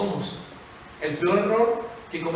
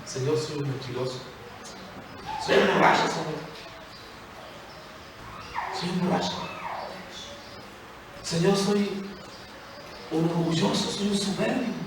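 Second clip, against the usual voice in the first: humble confession; 7 dB quieter than usual. Pretend. Voicing a sinner's confession prayer.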